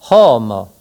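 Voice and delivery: slow, carefully spelled-out homo; Esperanto word. Shown as a demonstration. Teacher showing homo to French learners.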